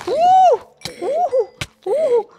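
short high loud whoops of alarm; gritos de susto. Real, not acted. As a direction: yelp "uh" with each hit. Fighting off an attack. (alaridos cortos y agudos)

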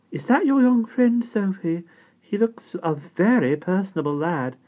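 high, loud gracious duchess; a great lady approving the lad; high grand-lady voice. A narrator's reading. The Duchess speaks.

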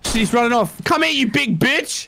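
high, frustrated shouting. Real, not acted. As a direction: yell in frustration. Chasing Bigfoot.

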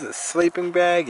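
usual speaking voice

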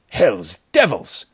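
slow, loud angry oath; cursing wolf. A narrator's reading. Bandit chief on seeing the wounded man, cursing. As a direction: spit out a drawn-out curse. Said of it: "cursed the wolf".

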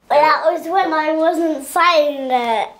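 slow words through real giggles; can't stop laughing. Real, not acted. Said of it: "I can't stop laughing".

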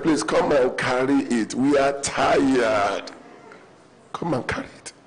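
slow gentle plea fading to a whisper; gentle pleading. Retold or imitated. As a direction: pastor gently begging, trailing into a whisper. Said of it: "pastor can be very gentle".

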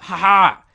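sudden loud gloating laugh; triumphant "ha ha". Real, not acted. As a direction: burst out a loud victorious "ha ha". Triumph at finding spot unknown.

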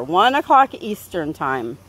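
normal talking voice

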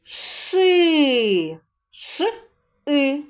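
teacher isolating sounds; sounding out ши. Shown as a demonstration. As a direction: split ши into Ш and И. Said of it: "Наступний склад".

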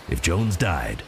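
ordinary delivery

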